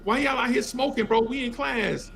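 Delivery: put-on high cheeky kid voice; high teasing kid voice. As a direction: needle the teachers like a brash kid. Kid questioning smoking teachers.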